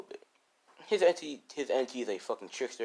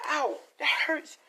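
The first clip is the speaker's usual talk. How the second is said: pained yelp. Acted. Scratched by wolf paw.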